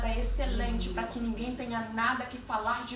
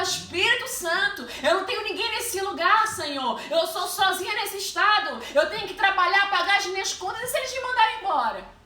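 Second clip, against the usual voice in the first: loud, high pleading prayer retold; retold plea, voice raised and urgent; impassioned preaching; 7 semitones higher, 5 dB louder than usual. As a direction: cry out to God from the pulpit. Retells her desperate prayer, preaching.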